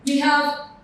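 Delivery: ordinary delivery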